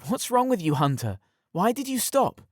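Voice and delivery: put-on high breathless young woman, panting. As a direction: gasp the words out between breaths. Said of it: "she said, panting".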